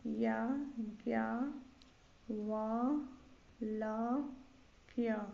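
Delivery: याज्ञवल्क्य drawn out syllable by syllable; spelled by syllables. Shown as a demonstration. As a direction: dictate याज्ञवल्क्य very slowly with gaps. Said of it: "यहाँ होगा".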